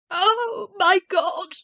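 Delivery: put-on an old woman crying out in shock; cried out. A narrator's reading. Old grandmother startled by running footsteps, high cry. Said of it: "cried a voice from the corner".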